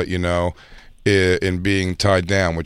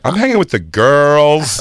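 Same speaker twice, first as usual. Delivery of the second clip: higher, drawn-out carefree cheer; mock party-girl defiance; 5 semitones higher, 3 dB louder, slower than usual. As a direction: stretch it out, brushing him off. Voices woman dismissing the call.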